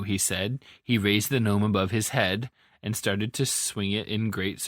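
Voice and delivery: ordinary delivery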